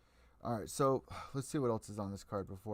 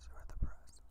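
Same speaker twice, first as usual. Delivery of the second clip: softer voiceless whisper, fading out; 5 dB quieter than usual. Acted. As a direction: let the repeated phrase sink into a quiet whisper. Repeating the point to media.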